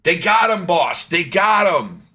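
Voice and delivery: high-pitched alarmed yell to the boss; panicked bandit yell. Acted. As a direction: cry out like a bandit raising the alarm. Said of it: "you heard red shirt guy yell".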